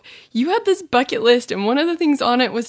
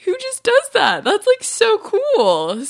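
high, gushing awe; retold fan amazement, drawing out the praise slowly; amazed admiration; 11 semitones higher, slower than usual. Interviewer recalls reacting to guest's movie role, high and awed.

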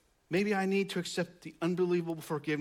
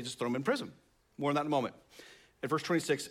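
ordinary delivery

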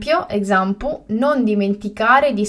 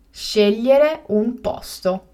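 slow and carefully spaced; slow, clear repetition; slower than usual. Shown as a demonstration. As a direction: say it again slowly for learners. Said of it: "teacher repeats the word twice".

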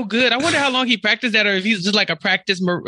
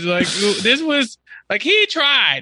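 usual speaking voice

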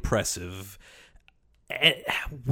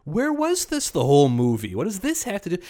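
baffled outburst; 12 semitones higher than usual. Retold or imitated. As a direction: pitch shoots up high, mock disbelief. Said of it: "We're sitting there going".

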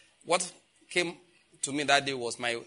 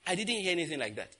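ordinary talk

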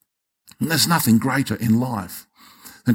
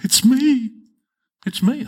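high-pitched imitation of a weeping woman; mock-tearful, voice lifted high; retold tearful confession; 13 semitones higher, slower than usual. Young woman owning up to the pastor, imitated as tearful and high. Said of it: "and, like, weeping".

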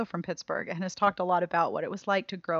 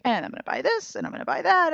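much higher, playful mock eagerness; imitating eager self; 9 semitones higher than usual. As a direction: voice her own shopping impulse, high and bright. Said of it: "I'm like".

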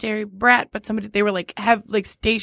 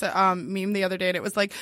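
ordinary talk

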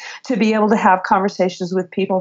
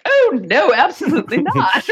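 higher, laughing mock British denial; British accent, laughing; 9 semitones higher than usual. British colleague denies knowing how, laughing in mock British accent. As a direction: cheerfully play the British colleague saying no. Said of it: "He said".

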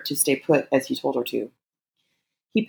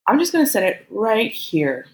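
higher-pitched, deliberate teasing; playful taunt; 6 semitones higher than usual. A narrator's reading. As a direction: drop it in lightly, pitched up. Character taunts lover in story.

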